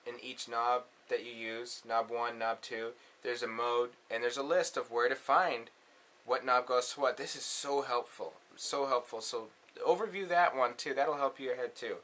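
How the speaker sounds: usual speaking voice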